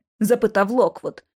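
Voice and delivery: ordinary delivery, nothing marked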